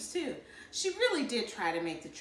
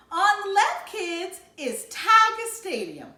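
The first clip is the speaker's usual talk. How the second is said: higher, louder, slow guide voice; tour guide mom; 12 semitones higher, 8 dB louder, slower than usual. A narrator's reading. Mother points out sights to the kids, announcing.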